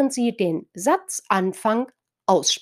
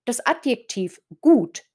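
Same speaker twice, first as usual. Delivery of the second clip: ordinary talk, nothing marked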